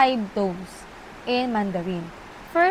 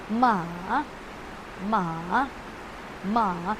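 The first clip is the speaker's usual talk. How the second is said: "Ma" dipping down, then swinging up; dipping rising tone; 4 semitones lower, 5 dB quieter, much slower than usual. Shown as a demonstration. Teacher demonstrating third tone, "Ma" falling then rising. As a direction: draw out "Ma" down low and back up. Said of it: "pababa, and then pataas".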